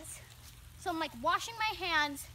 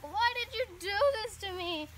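higher, louder whine for the dog; mock whiny dog, higher and louder; 6 semitones higher, 7 dB louder than usual. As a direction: whine it like a put-upon pup. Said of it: "He's very whiny 'cause he's like".

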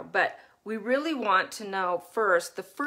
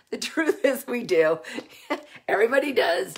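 laughing while admitting it; 3 semitones higher, 6 dB louder than usual. Real, not acted. Parenting coach laughing at herself to viewers. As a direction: giggle through the words.

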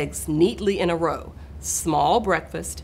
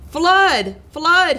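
loud high warning call, falling at the end; alarm cry; 9 semitones higher, 7 dB louder than usual. A narrator's reading. Cat trying to move the birds, alarmed shout. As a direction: cry it out like an emergency. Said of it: "he cried".

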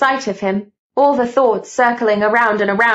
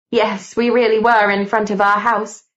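ordinary delivery, nothing marked

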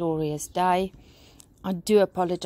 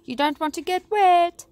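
high, raised-voice warning; 9 semitones higher, 4 dB louder than usual. A narrator's reading. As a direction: warn the crowd, rising in pitch. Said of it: "she warned".

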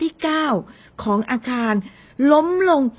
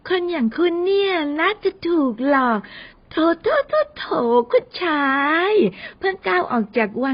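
nasal mocking; 3 semitones higher than usual. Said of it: "เอมี่ทำเสียงขึ้นจมูก". (ทำเสียงขึ้นจมูกประชด สูงแหลม)

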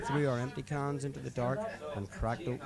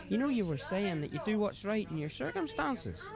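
put-on higher-pitched drunken friend preaching life advice; drunk mate Paddy; 9 semitones higher than usual. Drunk driver lecturing his passenger about responsibility, higher voice. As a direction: lay out your drunken philosophy earnestly.

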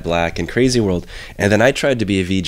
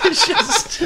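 laughing. Real, not acted. Laughing at his teasing.

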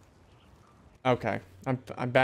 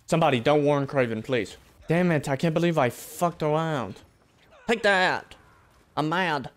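normal talking voice

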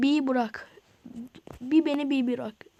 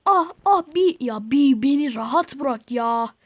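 louder, slow, fed-up begging to be left alone; exasperated plea; 7 dB louder, slower than usual. Real, not acted. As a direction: sound at the end of your rope. Harassed by enemy in game.

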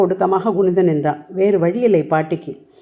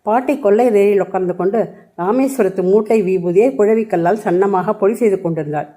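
ordinary delivery

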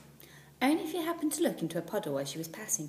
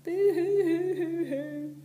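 high, slow sing-song boo-hoo wail; drawn-out wailing cry of a child; sobbing child's boo-hoo heard through the floorboards; 11 semitones higher, 8 dB louder, much slower than usual. A narrator's reading. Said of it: "heard the crying again".

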